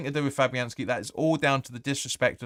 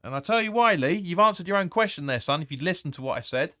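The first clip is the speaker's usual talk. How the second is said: louder, higher telling-off of a chat commenter; higher, louder scolding; exasperated retort; 5 semitones higher, 3 dB louder than usual. Real, not acted. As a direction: put Lee in his place, voice raised. Snapping back at chat commenter.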